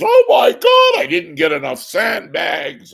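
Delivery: mock a frantic old lady crying out; hammed-up old woman yelling in panic. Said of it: "they show these old ladies".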